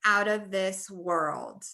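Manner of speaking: "out of this world" slowly, WORLD stretched out; phrase stress. Shown as a demonstration. Teacher models phrase stress, "world" stretched in "out of this world". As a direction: draw out "world" in "out of this world". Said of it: "stretched out a little bit more".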